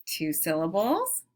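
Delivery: ordinary delivery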